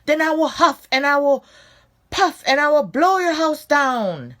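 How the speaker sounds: loud, high roaring threat; roaring shark. Acted. Upset shark roaring at the pigs, loud and high. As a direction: bellow the threat at the house. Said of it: "so he roared".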